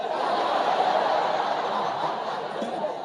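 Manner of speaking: breathless burst of laughter; big laugh. Real, not acted. Comedian laughing at his own story, real laughter. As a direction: crack up at yourself.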